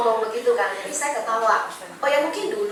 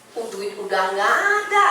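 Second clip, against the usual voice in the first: grumbling inner voice; 5 semitones lower than usual. Retold or imitated. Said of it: "Dalam hati".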